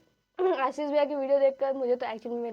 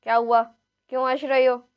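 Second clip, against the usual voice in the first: mock stern teacher scolding a giggler, a touch louder; imitating Hindi teacher; 2 semitones lower, 7 dB louder than usual. Imitating her old Hindi teacher scolding a laughing student, mock stern. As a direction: play her strict old Hindi ma'am asking why you're laughing. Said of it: "Hindi वाली ma'am ऐसे दिखती थी मेरी".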